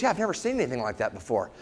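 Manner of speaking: quick, low, soft aside; imitating a casual doctor; offhand doctor. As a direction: toss it off low and fast. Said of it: "He goes".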